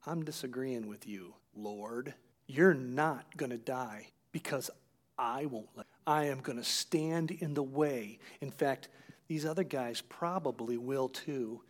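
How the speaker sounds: soft, hushed plea to the Lord; a quiet, urgent aside to Jesus; retold whisper. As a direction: keep it low and private, pulling him aside. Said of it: "so he can whisper to him".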